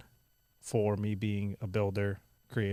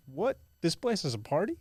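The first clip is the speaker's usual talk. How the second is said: amazed; 7 semitones higher than usual. Retold or imitated. Retells shock at the fireworks.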